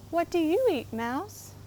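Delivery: proud little frog, lightly voiced; a hint of a cheerful boasting frog. Frog asks the mouse.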